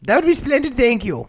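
high, posh gracious lady voice; Felicity Bloody Dump. Pretend. Invited to dinner, Felicity accepts in a high posh voice. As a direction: accept the invitation like a grand dame. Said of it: "Hey, Felicity".

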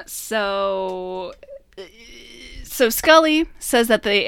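usual speaking voice

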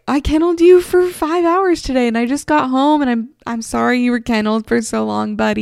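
lightly voiced guilty owner cooing to buddy; sorry dog owner. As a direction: coo an apology to the pup. Apologizing to her kenneled dog.

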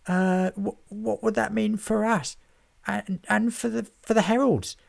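high, stammering nervous scribe; quivering voice. A narrator's reading. A nervous scribe asks the editor, high and stammering. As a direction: shake with nerves asking. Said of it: "with quivering voice".

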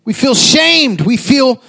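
impassioned preacher, rising intensity; preaching. Acted. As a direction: preach it to the crowd. Preaching at full force.

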